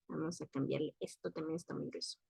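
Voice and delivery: low, very soft murmur; talking to herself. Real, not acted. Teacher adjusting text on screen, murmuring to herself. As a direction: mutter softly as you work.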